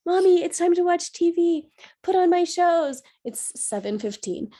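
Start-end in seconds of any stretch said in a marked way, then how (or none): 0.1-3.0 put-on high, loud little-girl demand; daughter's voice. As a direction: play the bossy three-year-old wanting TV. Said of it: "And she's like".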